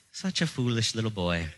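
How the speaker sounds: teasing quote in a mock Irish accent. Preacher quoting his childhood crush, mock Irish accent. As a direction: put on an Irish lilt for her. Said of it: "an accent from Ireland".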